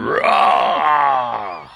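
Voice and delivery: loud effort roar; straining grunt. Real, not acted. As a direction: heave at a stuck lid. Straining at stuck jar.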